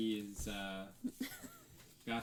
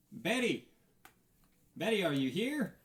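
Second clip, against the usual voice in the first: loud, higher call across the yard; 9 semitones higher, 10 dB louder than usual. Acted. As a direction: call for Betty, louder and higher. Calling out at scrapyard.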